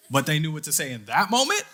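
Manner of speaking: loud, climbing disbelief breaking into a laugh; incredulous amazement. Real, not acted. Father marvelling that his girls knew what to say, loudly incredulous. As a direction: let the voice leap up in amazed delight.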